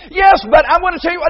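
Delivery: high, fast, loud protesting whine; defensive protest. Retold or imitated. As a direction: blurt out a defensive excuse. Acting out a defensive child.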